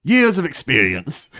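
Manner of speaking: lifted, lingering pirate drawl; Jack Sparrow. Acted. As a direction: answer in character, drawn out like a boozy pirate. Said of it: "sound like Jack Sparrow".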